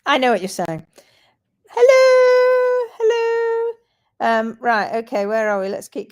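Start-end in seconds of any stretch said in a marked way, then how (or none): 1.7-3.7 high sing-song hello; sing-song greeting. Real, not acted.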